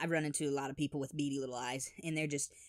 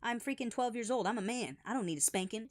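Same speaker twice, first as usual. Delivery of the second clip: put-on higher-pitched indignant boy; indignant protest; 7 semitones higher than usual. Boy telling dad he is a man, high indignant protest. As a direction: protest like an insulted twelve-year-old.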